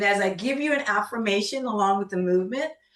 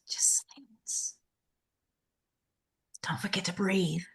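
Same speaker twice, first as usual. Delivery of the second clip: soft unvoiced whisper; 8 dB quieter than usual. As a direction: whisper it gently. Guiding silence.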